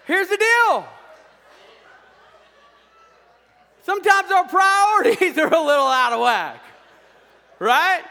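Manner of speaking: high, loud preacher's shout; shouted preaching. Real, not acted. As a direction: belt it out to the whole congregation. Bursts out after joke punchline.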